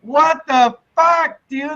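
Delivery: high, slow incredulous yell; mock shocked outburst; disbelief. Watching the uncut scene in disbelief. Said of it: "And I'm like".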